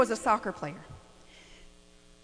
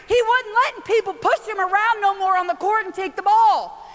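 ordinary talk, nothing marked

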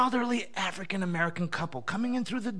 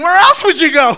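incredulous outburst; 7 semitones higher, 15 dB louder than usual. Retold or imitated. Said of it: "was thinking".

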